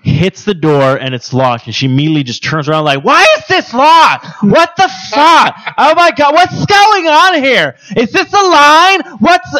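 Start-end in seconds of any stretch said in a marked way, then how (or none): 3.0-9.5 put-on high, loud, drawn-out drunken outburst; drunk woman. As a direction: shriek it slowly, like an outraged drunk. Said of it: "this woman was drunk".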